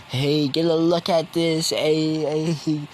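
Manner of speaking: high, slow, calling hey across the crowd; hollering to the gang to come look; excited crowd calling out. Retold or imitated. Gang members call out, excited and high-pitched.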